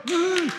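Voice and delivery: high cheering woo; excited whoop. Real, not acted. Pastor cheering for Deanna, excited whoop. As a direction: cheer for the new citizen. Celebrating new citizen.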